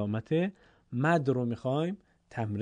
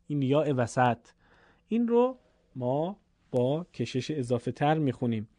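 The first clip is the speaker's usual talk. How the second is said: ordinary talk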